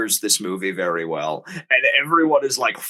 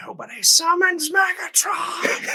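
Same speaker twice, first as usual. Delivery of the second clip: high, slow mock villain shout; Megatron shout. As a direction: boom it out like a robot tyrant. Repeating quote, laughing.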